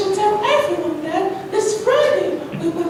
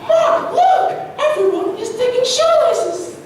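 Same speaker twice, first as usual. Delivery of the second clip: put-on girl's pitched-up excitement; excited; 3 semitones higher than usual. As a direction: call your friend to look. Girl excitedly points out success.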